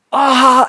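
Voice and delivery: loud, high imitated guffaw; exploding fake laugh; mock burst of laughter. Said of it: "starts keeling over laughing".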